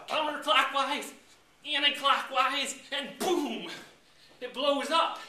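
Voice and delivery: high and loud, slow build to boom; hammed-up high voice as the cake explodes; mock dramatic. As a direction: chant it like a forbidden spell then boom. Stirring the cake illicitly.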